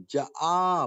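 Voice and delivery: جاء broken into short ja, separate long aa; split wrong reading. Retold or imitated. Teacher imitates learners' mistake, ja-aa split in جاء. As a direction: split the jeem from the alif in جاء. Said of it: "جیم کو الگ پڑھ دیتے ہیں".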